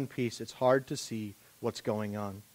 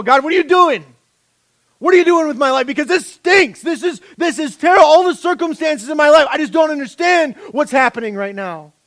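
much higher and louder exasperated complaint; fist-shaking complaint; 13 semitones higher, 14 dB louder than usual. Retold or imitated. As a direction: shake your fist at God and yell your frustration. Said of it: "when you shake your fist and you go".

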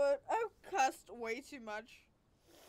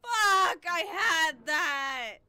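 angry shout; 3 semitones higher, 10 dB louder, much slower than usual. Real, not acted. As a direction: yell it, the last words dragged out slowly. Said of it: "just by screaming at this game".

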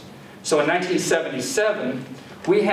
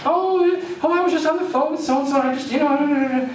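much higher, carefree chatter of a late mother; breezy excuse, not a care in the world; happy mother; 11 semitones higher than usual. Retold or imitated. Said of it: "and just happy".

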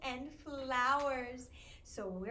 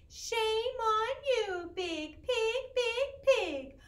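slow, mock scolding, a little higher; tut-tut at the pig like a gentle farmer; 6 semitones higher, 4 dB louder, much slower than usual. Farmer scolding the pig in the rhyme, mock stern. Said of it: "when I'm talking like the little farmer".

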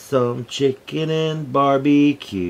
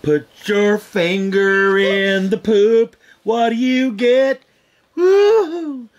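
high, slow playful taunt; slow teasing singsong; 7 semitones higher, 3 dB louder, much slower than usual. Pretend. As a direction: stretch it out teasingly. Grandpa mock-taunting, slow high.

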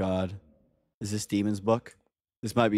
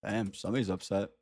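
ordinary delivery

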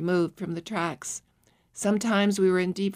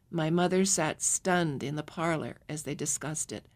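ordinary delivery, nothing marked

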